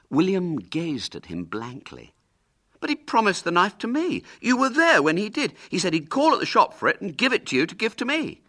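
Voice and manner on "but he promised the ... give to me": put-on high, loud, insistent boy; indignant protest. William protesting to Hubert over the knife, indignantly.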